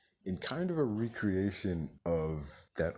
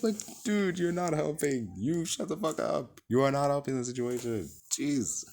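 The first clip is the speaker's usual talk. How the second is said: raised, higher frustrated voice; exasperated rant; 8 semitones higher than usual. Real, not acted. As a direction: vent at the screen. Real rant at the screen.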